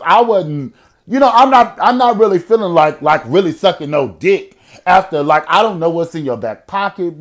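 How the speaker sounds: loud, high comedic rant; hammed-up vulnerable outburst; worked-up protest. Acts out feeling vulnerable, comedic rant.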